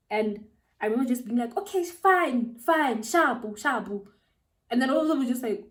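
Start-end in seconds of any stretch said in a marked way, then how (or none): 1.6-4.1 high, exasperated giving-in; pissed off. Retold or imitated. Giving in to the boys pressing her, pissed off. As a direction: act out fed-up surrender, starting high and easing down. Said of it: "I was so pissed".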